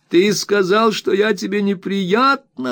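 very high wounded accusation; very high wounded wife's accusation. A narrator's reading. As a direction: declare it up high, deeply hurt. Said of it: "объявила Дора".

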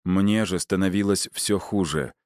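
usual speaking voice

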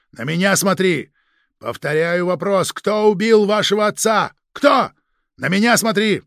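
loud, demanding shout; frantic shouting hostage taker. Acted. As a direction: bark orders at the suspect. Interrogator shouting at suspect. (требовательный крик на подозреваемого)

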